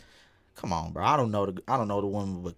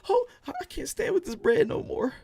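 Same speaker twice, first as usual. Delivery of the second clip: high, breaking fake sob; mock crying; 14 semitones higher than usual. Streamer faking tears over Xbox news, high wailing sob. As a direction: wail it like a heartbroken fan. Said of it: "Just crying".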